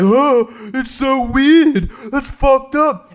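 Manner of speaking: high, loud, slow mock alarm; hammed-up shocked guy. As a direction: overplay a horrified guy. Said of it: "for the guy to be like".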